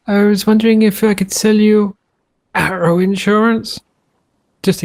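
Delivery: a hint of a cheesy door-to-door salesman; mock salesman. Pitching insurance to a shark.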